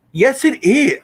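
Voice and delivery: sharp, high insistence; high snapped yes it is; insistent high retort. Real, not acted. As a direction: fire back, it's true. Arguing with chat.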